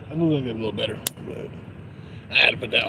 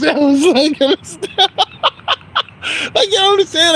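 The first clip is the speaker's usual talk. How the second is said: high, loud words breaking into laughter; helpless laughter; 4 dB louder than usual. Real, not acted. Telling a story about work, cracking up laughing. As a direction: squeak it out through real laughter.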